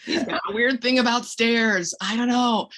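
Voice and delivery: amused and dismissive, chuckling; laughing aside. Shown as a demonstration. Parent joking to child about Uncle Jim, laughing.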